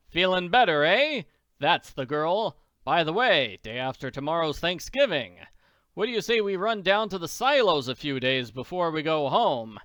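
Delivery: a big-hearted millionaire taking charge; hearty Daddy Warbucks. Acted. Warbucks tells Annie he'll soon fix her hunger, loud and hearty.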